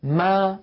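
ما with the mouth opening wide on the vowel. Shown as a demonstration. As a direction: open the lips clearly for ما. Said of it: "هكذا ما".

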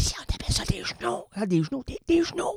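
whispered mock director voice; hushed quick impression of the director; imitating director. Director correcting him, soft mock voice. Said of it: "ma directrice qui me dit".

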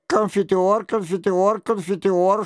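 plain reading voice

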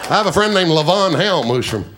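ordinary talk

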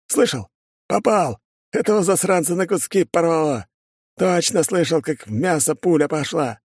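high-pitched, gleeful bragging; exultant. Acted. Shooter boasts to his mate of the hit, exultant. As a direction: crow over your lucky shot.

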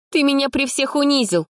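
high, wounded accusation; high tearful reproach; hurt girl. A narrator's reading. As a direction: blame him for humiliation. Polina hurt, near tears.

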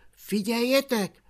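ordinary delivery

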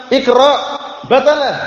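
Jibril commanding. Retold or imitated. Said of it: "Malaikat Jibril alaihissalam mengatakan".

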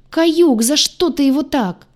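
high, loud protest; yelling. A narrator's reading. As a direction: wail it in outrage. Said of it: "сразу заорал".

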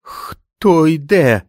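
high, slow and quivering, scared to the bone; a frightened young sentry calling into the storm; young trembling voice. A narrator's reading. Young sentry calls out into the blizzard, high and trembling. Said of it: "почувши молодий і тремтливий голос".

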